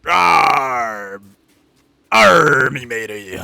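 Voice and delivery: loud hammed-up pirate growl. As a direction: play a cartoon pirate. Pirate role play.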